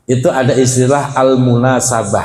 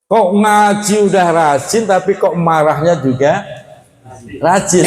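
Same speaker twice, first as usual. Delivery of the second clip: mock wonder; 6 semitones higher than usual. Preacher mocks those quick to anger.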